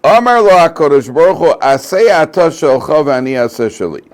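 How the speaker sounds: teacher reading Rashi aloud to his students, fervent; fervent text reading. Acted. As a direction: declaim the text to the class with force. Reads Hashem's reply.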